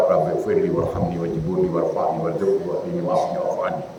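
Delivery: rattled off low and soft, words run together; hurried mumbled prayer. Shown as a demonstration. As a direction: rush the prayer under the breath carelessly. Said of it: "Gitulah caranya".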